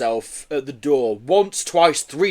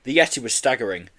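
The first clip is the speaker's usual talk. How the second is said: ordinary delivery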